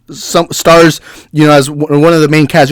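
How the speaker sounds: usual speaking voice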